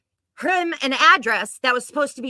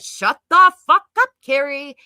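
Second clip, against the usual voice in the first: retold shout; 6 dB louder, slower than usual. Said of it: "I said".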